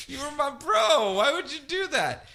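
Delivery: high, wounded whine; high betrayed whining; hurt bro. Retold or imitated.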